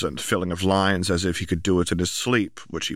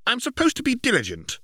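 protesting. Acted. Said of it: "he protested".

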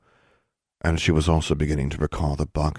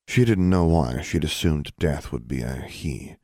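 ordinary delivery

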